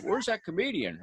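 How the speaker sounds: a hint of a mocking [name], high and soft. Impersonator mocks the opener from the stage, lightly voiced Cash. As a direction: tease the comic like a country star. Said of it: "in [name] voice".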